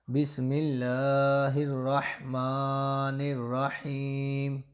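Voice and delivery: slow, measured recitation. Acted. Teacher opening the reading lesson, slow solemn recitation. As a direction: intone it slowly like a blessing.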